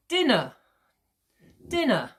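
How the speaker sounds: "DIN-ner", din high and falling away; first-syllable stress. Shown as a demonstration. Teacher showing two syllables, stress on din. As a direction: hit "din" in "dinner", uh light. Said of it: "uh is less pronounced than the din".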